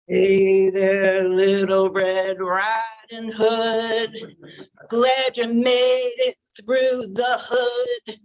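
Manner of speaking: slow, sly predator voice; wolf's gloating thoughts. Acted. Wolf thinking about Red, slow sly gloating. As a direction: savour each word like a hungry wolf.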